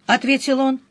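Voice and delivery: ordinary delivery, nothing marked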